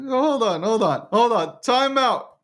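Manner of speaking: high, squeaky pleas through laughter; helpless giggles. Real, not acted. Host calls for a timeout from the coach, giggling helplessly. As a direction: beg for a break while cracking up. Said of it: "I got the giggles".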